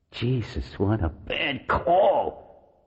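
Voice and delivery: slow, rueful inner thought; regretful thought to oneself. A narrator's reading. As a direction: drag it out in self-reproach. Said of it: "he thought ruefully".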